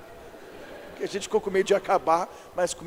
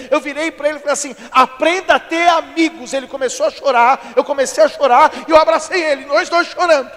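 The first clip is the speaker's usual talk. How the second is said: loud, raised, driving sermon delivery; preacher crying out to the congregation; full-force preaching; 7 semitones higher, 11 dB louder than usual. Acted. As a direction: build to a high, shouted exhortation.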